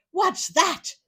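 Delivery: high, loud startled question; startled exclaim. Acted. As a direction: gasp it out in shock. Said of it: "exclaimed the tailor".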